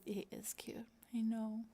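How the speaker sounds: soft whisper agreeing; whispered aside. Real, not acted. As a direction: lean in and whisper it. Whispered aside about the cat.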